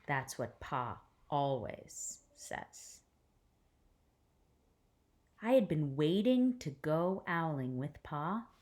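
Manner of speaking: gentle read-aloud storytelling voice; hushed, careful picture-book reading; story narration. A narrator's reading.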